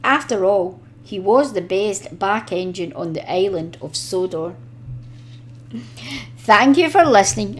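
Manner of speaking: usual speaking voice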